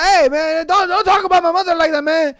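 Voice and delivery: high, loud, rapid protest; mock quarrel. Cook defending his mother, mock angry.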